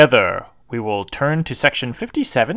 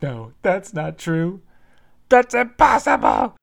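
rising cry of disbelief; anguished hero parody; 6 semitones higher, slower than usual. Acted. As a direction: wail it like a shocked young hero. Parody Luke's anguished reply.

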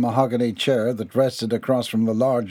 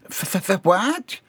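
stutter, then pop up high on WHAT; stammering disbelief; 9 semitones higher than usual. A narrator's reading. Employee accused of fraternizing, stammering innocence.

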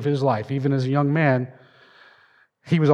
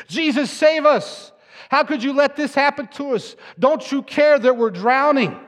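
panicked cry; 9 semitones higher than usual. Acted. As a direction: cry out as if sinking in the storm. Disciples cry to sleeping Jesus.

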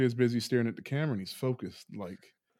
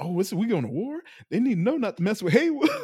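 indignant Emerald; 9 semitones higher, 7 dB louder, faster than usual. Retold or imitated. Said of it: "And she's like".

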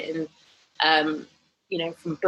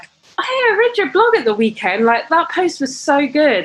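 high, louder imitated praise; chirpy coworker; 9 semitones higher, 5 dB louder than usual. Colleague praising her weekend post, high and chirpy. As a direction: gush like a cheerful colleague.

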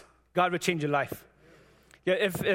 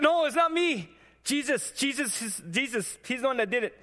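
excited protest; 7 semitones higher than usual. Retold or imitated. Telling his coworker it was Jesus, high and excited. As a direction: give all the credit away, thrilled. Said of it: "I said".

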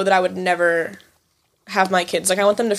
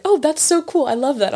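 gushing mom; 5 semitones higher than usual. Retold or imitated. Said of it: "and now it's like".

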